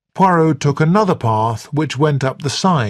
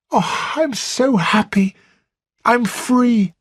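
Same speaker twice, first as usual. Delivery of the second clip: put-on young woman near ecstatic, higher and lifting; elated Flora; 9 semitones higher than usual. A narrator's reading. Flora to Blunt, celebrating her freedom, high and elated. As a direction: savor each word of freedom.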